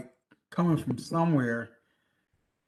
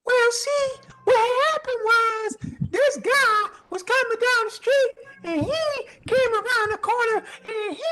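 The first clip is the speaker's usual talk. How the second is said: mocking witness voice; 6 dB louder than usual. Witness explaining events to the cops, high squeaky mimicry. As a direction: squeak out the story like a nervous witness. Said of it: "This is how he said it".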